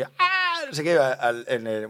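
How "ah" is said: retold kids' scream. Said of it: "recuerdo a los chiquillos gritando".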